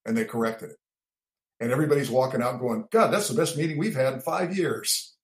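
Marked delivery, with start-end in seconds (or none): 2.9-5.2 high, delighted crowd voice; impressed exclaim. Retold or imitated. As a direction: gush like happy officers. Everybody walking out going.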